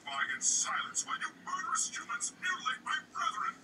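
whispery robotic tree voice. Acted. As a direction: speak like a creepy robot. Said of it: "why do you sound like a robot".

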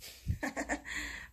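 short airy chuckle; a quick laugh through the nose; breathy laugh. Real, not acted. Laughs at the bird's nest.

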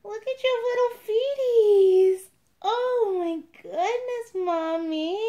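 very high, drawn-out gushing coo; baby talk. Pretend. Cooing to doll like a baby.

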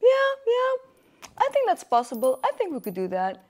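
a hint of a playful high voice for her date; lightly voiced date, teasing; joking husband. Her date checking his watch, lightly voiced joking reply. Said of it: "he was so cute, jokingly".

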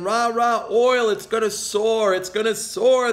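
mock the bulls with a cheerleader chant; hammed-up cheer for soaring oil; mock bullish cheer. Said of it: "they're saying".

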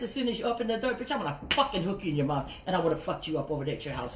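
ordinary delivery

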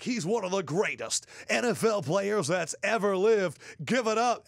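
re-enacted crowd warm-up; hype-man introduction. Acting out his hype introduction.

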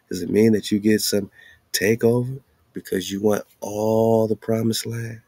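ordinary talk, nothing marked

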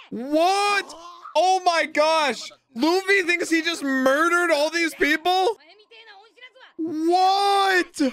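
high-pitched, incredulous outburst; real disbelief. Real, not acted. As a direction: cry out in shocked disbelief. Shocked at Luffy's misunderstanding.

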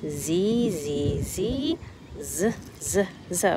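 Z buzzed out as a steady zzz sound; letter sound drill. Shown as a demonstration. Teacher modelling the Z sound for young pupils, buzzing. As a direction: buzz the sound Z over and over. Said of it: "Makes the Z sound".

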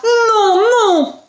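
high cry of protest; alarmed, high-pitched mother's cry. Acted. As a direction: cry out to halt the blow. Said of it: "une voix cria".

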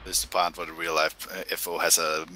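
usual speaking voice

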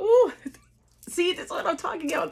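breathless laugh, pitch jumping up; thrilled gasp with a laugh; excited goosebumps. Real, not acted. Fan reliving the match, giddy with goosebumps. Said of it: "the goosebumps".

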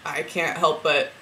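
ordinary delivery

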